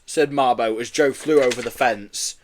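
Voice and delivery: ordinary delivery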